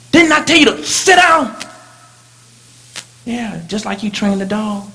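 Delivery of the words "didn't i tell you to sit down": put-on sharp mother scolding a child; scolding parent. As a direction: snap at the child to sit down.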